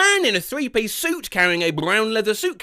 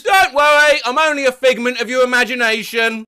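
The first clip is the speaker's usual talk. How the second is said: loud, high cheerful yell; yelled reassurance; 5 semitones higher, 6 dB louder than usual. Retold or imitated. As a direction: call it out while whizzing by. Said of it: "he yelled".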